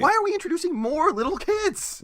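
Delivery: high, put-upon complaint; exasperated whine. Real, not acted. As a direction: whine it in mock despair.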